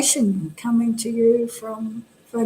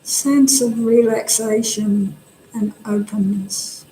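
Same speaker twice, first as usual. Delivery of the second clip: calm body-scan voice, long silences between phrases; guided meditation. Real, not acted. Teacher guiding meditators to relax the face, calm and unhurried. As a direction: guide them gently, leaving space after each instruction.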